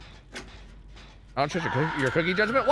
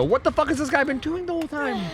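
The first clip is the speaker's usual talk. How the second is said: incredulous outburst. Real, not acted. Teammate healing late, disbelief.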